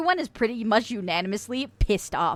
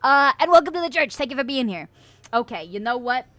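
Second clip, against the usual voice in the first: ordinary delivery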